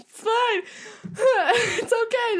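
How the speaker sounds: high, teary, hammed-up "it's okay"; mock-cry while soothing yourself; mock sobbing comfort. Telling a friend how she sobbed at the show, mock-teary. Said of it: "I was just like".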